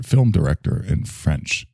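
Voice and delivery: ordinary delivery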